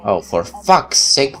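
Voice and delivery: usual speaking voice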